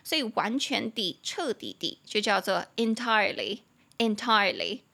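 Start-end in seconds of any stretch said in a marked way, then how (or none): 2.8-4.8 "entirely" in British English, no R sound; British pronunciation. Shown as a demonstration. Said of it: "这个是英式的发音".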